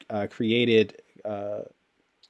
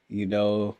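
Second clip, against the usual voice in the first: ordinary talk, nothing marked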